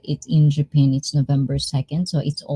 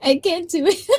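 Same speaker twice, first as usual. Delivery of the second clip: high, real giggle through the words; squeaky laughing admission. Real, not acted. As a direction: give up in helpless laughter. Cracking up at own witch attempt.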